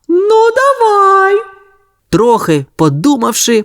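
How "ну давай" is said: very high, very slow agreement; agreeing. A narrator's reading. The worker accepts the sausage, slow high voice. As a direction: agree slowly from below, high. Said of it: "погодилася луна".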